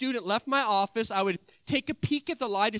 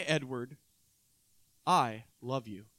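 a soft, much lower, slower I love you; quiet and sincere, lower and slower; tender; 9 semitones lower, 5 dB quieter than usual. Retold or imitated. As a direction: say it gently, almost under your breath. Teacher's tender closing words to student.

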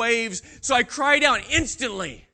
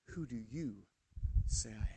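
low and much softer, intimate; soft searching question; 11 semitones lower, 14 dB quieter than usual. Acted. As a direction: ask it gently, eye to eye. Jesus asks Peter quietly.

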